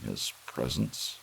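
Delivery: plain reading voice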